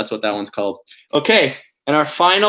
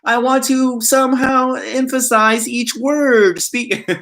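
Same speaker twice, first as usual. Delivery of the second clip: much higher, slow mock-solemn voice; pun reading voice; 11 semitones higher, slower than usual. Shown as a demonstration. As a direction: stretch out each word, like a grand sage. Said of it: "I always put on that voice".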